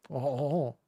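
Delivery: ordinary talk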